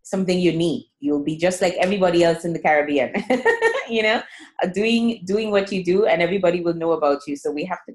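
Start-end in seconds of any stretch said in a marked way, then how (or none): 3.2-3.8 a quick bright laugh breaking into the sentence; short laugh. Real, not acted. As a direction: laugh lightly, then carry on talking. Host laughs mid-sentence.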